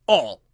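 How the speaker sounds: ALL hit loud and hard; a loud, weighty "All"; great emphasis. A narrator's reading. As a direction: punch the single word "All". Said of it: "he repeated with great emphasis".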